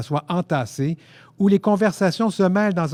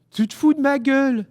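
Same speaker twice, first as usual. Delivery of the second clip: much higher, piercing disbelief; strident outrage; 11 semitones higher than usual. A narrator's reading. Frenchman challenging the narrator over the coincidence, strident. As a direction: shoot up high in offended shock. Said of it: "sur le même ton strident que le sien".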